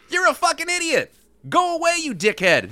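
high-pitched, heated yell; acted angry scream. A narrator's reading. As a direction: scream back at him in mock fury. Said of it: "the older man screams back".

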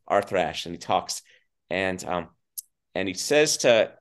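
usual speaking voice

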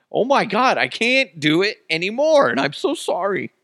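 high, wailing apology; comic high-pitched despair; exasperated giving up. Real, not acted. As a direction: whine it out, defeated by your own bit. Gives up on failed accent bit.

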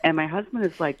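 normal talking voice

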